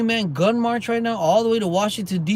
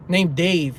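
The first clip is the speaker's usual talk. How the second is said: usual speaking voice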